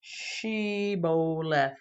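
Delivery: Shibboleth drawn out slowly, sh, i, bo, leth; teaching the correct way; slow careful pronunciation. Shown as a demonstration. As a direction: sound out Shibboleth syllable by syllable. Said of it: "This is the correct way".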